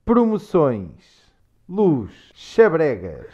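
"Promoções", "Luz", "Xabregas" with hushing final s; Portuguese pronunciation demo. Shown as a demonstration. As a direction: read "Promoções", "Luz", "Xabregas" one by one, Lisbon-style. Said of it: "read through this list of words".

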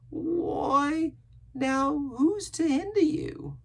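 high, slow, drawn-out sailor voice; sly castaway, questioning. A narrator's reading. Castaway probing the boy, startled slyness. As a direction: stretch out the question, high and loud.

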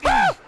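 very high loud shriek of fright; startled scream. Real, not acted. Gamer jumped by a monster, screaming in shock. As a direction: shriek as something jumps you. Sudden attack in game.

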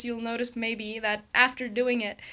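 ordinary talk

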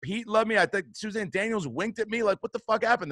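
baffled, acted disbelief; indignant protest. Acts out protest at losing part.

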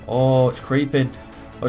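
ordinary talk, nothing marked

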